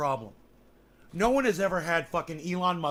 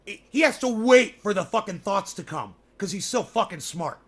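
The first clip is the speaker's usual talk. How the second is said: ordinary talk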